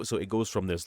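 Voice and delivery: ordinary talk, nothing marked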